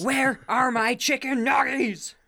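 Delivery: high, slow, whiny demanding voice; whiny man-child voice. Pretend. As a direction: whine like a spoiled man-child. Imitating whiny neckbeard.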